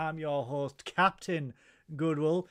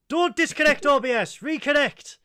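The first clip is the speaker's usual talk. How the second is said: frustrated; 7 semitones higher, 7 dB louder than usual. Real, not acted. Fighting the streaming software.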